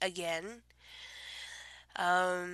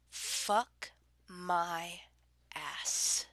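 breathy, hushed dirty-talk whisper; sultry whisper; 9 dB quieter than usual. Pretend. Reading a dirty word list, she drops to a seductive whisper. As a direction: murmur it close to the mic like pillow talk.

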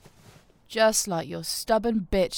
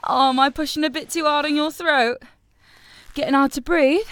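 gloating taunts; 5 semitones higher, 5 dB louder than usual. Acted. Louder mocking taunts.

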